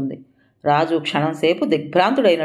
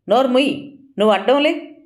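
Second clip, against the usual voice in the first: angry order; 7 semitones higher than usual. A narrator's reading. Raju snaps at Vijaya.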